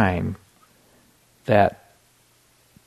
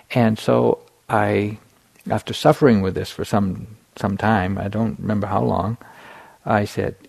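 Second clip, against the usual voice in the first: normal talking voice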